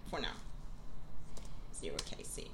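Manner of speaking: soft unvoiced gloat; whispering. Real, not acted. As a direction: whisper the score teasingly.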